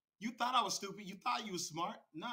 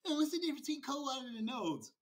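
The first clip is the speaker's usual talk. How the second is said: higher-pitched mock naive question; mocking questioner; 6 semitones higher than usual. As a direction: put on a silly questioner's voice.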